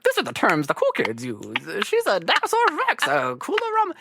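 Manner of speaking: high, lightly put-on old man; a hint of clueless old executives; old-man parody.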